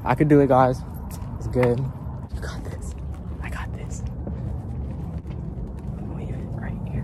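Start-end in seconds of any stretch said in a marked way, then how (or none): 1.4-7.0 whispered pep talk to himself. Real, not acted. Sneaking a gift onto her porch, whispering to himself. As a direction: hush it so nobody inside hears.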